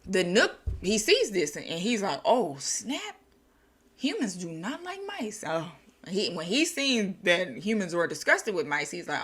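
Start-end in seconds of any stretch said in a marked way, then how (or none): 2.2-5.4 surprised. Retold or imitated. Nook realizing mistake.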